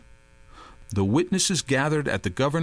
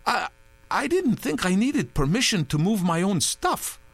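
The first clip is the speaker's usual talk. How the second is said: flustered; 9 semitones higher than usual. A narrator's reading. Touré flustered.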